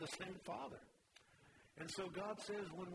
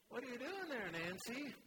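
light, much higher voice for the nosy neighbor; neighbor's voice; 8 semitones higher than usual. Acted. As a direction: pitch the neighbor up, curious. Neighbor asks Nancy in joke.

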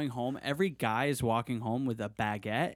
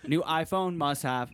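robot American; 5 semitones higher than usual. Acted. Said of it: "derogatory sound of an American".

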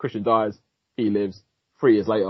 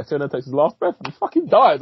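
usual speaking voice

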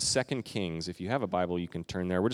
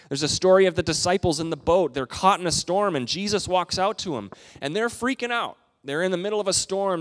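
louder, higher storm tale; excited storytelling; 8 semitones higher, 5 dB louder than usual. Acted. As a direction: build the drama of the storm.

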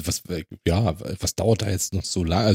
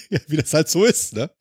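breathy laugh into the words; 5 semitones higher than usual. Real, not acted. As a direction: laugh while agreeing. Laughing reply.

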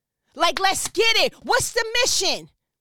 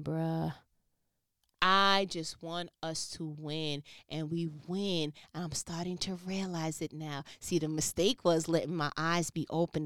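much softer and lower, slowed down; hushed earnest; 13 semitones lower, 10 dB quieter than usual. Real, not acted. Confiding to his listeners in a hushed earnest voice. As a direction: drop to a low near-murmur, sincere.

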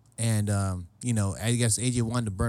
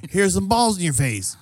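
raised, higher playful jeer; drunken taunt; 7 semitones higher, 4 dB louder than usual. Acted. Drunk buddy heckling at a party, raised jeer.

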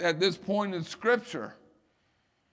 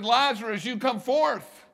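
commanding call; 6 semitones higher, 6 dB louder than usual. Retold or imitated. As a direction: call the dead man out by name. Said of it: "He said".

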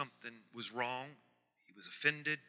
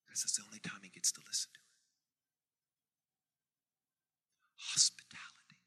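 hushed aside; 7 semitones lower, 6 dB quieter, faster than usual. Real, not acted. Hushed punchline of story.

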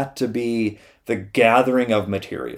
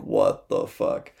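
stunned slow disbelief; 3 dB quieter, much slower than usual. Retold or imitated. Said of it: "it's just like".

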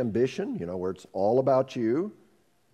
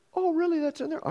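much higher, feigned shock; mock surprise. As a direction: act shocked, tongue in cheek.

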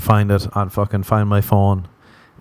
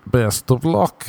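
slow best of luck; 3 semitones higher, much slower than usual. Shown as a demonstration. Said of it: "That's what I like to say".

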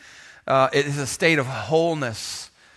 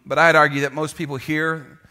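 normal talking voice

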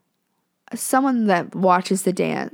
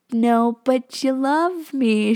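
higher, slow, drawn-out cajoling; playful teasing; 5 semitones higher, 4 dB louder, much slower than usual. Retold or imitated. Prankster answers Ella's "I hate you", playful teasing. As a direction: tease sweetly, stretching the words. Teasing back at angry friend.

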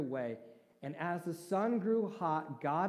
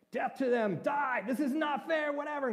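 high angry shout, much higher and louder; 12 semitones higher, 6 dB louder than usual. Retold or imitated. Preacher voicing Jonah shouting in anger at God. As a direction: yell it like a sulking prophet. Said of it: "what is Jonah doing".

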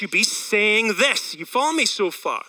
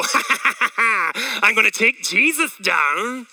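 mock evil devil. Pastor mocking a cartoon devil to the congregation, hammed-up villain laugh. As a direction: cackle then sneer like a pantomime devil. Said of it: "He didn't come and go".

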